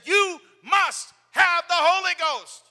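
slow, high, loud exhortation; urgent shout. Retold or imitated. Preacher pleading with the man in the hospital bed, shouting.

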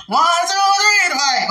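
high, loud count into the name; playful count-in. Pretend. Counting in to say Dwight together, playful shout. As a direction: call it out big, in play.

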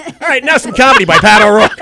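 big, loud, high stage introduction; hammed-up announcer shouting the next act on; upbeat emcee. Emcee after a tearful charity film, jarringly loud introduction.

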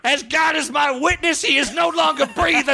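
wrestling commentator. Pretend. Jim Ross bit continues.

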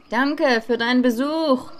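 called out after someone walking away; calling after him. Retold or imitated. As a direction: raise the voice to reach him. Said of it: "rief ich ihm hinterher".

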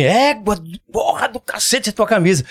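high, slow exclamation of being impressed; amazed "égua". Shown as a demonstration. Showing friends égua as admiration of a shirt, amazed. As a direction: marvel at it in Paraense slang. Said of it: "O ego lá assim".

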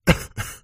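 coughing in a dust cloud; a cough from inhaled dust; dusty cough. Acted. Dust cloud makes Santa cough at the door. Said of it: "sai Pukin yskimään".

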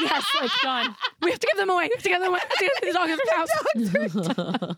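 high, breathless mock panic with laughter; hammed-up panic about the dogs; panicked. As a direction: laugh through a frantic plea. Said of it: "like, shaking".

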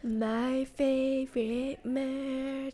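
slow, soft drawn-out intro; breathy true crime host delivery; podcast host voice. Retold or imitated. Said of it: "That's what that voice sounds like".

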